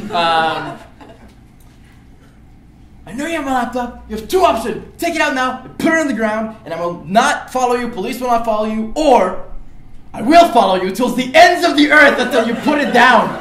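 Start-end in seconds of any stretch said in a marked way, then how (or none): 3.1-13.4 high, loud, deliberate threat with laughter at the end; mock-tough ultimatum; firm loud ultimatum. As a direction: lay out the terms sternly. Ultimatum to cornered thief.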